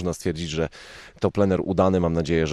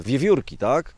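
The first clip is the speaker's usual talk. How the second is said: usual speaking voice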